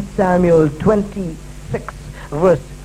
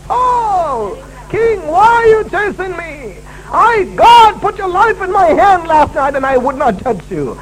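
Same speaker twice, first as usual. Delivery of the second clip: calling out; 8 semitones higher than usual. Acted. David yells out to Saul.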